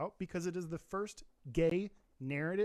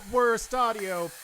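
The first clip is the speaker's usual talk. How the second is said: loud; 5 semitones higher, 10 dB louder, slower than usual. Real, not acted. Reacting loudly to the shout.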